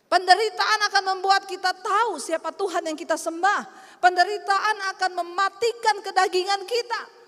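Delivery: loud, driving sermon voice; full-force preaching. Acted. Preacher rouses the congregation about suffering, loud. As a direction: drive each line home to the crowd.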